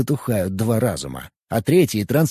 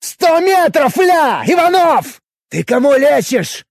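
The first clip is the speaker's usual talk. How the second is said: loud, high, slow furious shout; sudden shout; 14 semitones higher, 5 dB louder, slower than usual. Acted. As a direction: explode into a yell. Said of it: "резко повысил голос".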